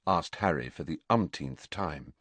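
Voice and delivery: usual speaking voice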